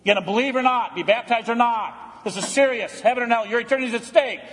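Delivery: usual speaking voice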